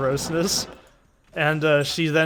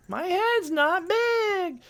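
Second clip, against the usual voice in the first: cartoon kid voice; much slower than usual. Quoting the cartoon boy Dib.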